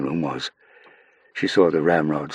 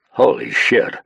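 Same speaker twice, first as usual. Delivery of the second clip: loud, higher shocked exclamation; shocked gasp; 7 semitones higher, 6 dB louder, slower than usual. Acted. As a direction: blurt it out on a gasp. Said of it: "gasped the guard".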